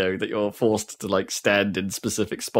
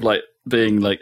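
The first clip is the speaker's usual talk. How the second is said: ordinary talk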